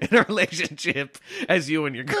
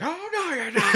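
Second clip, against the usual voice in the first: mock Ralph; 12 semitones higher than usual. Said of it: "He's like".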